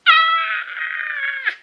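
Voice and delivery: high loud exasperated shriek; frustrated scream. Real, not acted. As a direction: let out a cry of exasperation. Frustration at mother's refusal.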